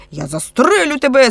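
high, loud furious threat; boiling over. A narrator's reading. Police chief threatening to shoot, high angry outburst. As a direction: let the temper burst out. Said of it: "скипів начальник поліції".

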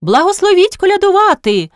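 high, carrying call; hallooing. A narrator's reading. Horpyna asking a second time at the window, hallooing. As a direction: call it out high towards the house. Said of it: "гукнула удруге Горпина".

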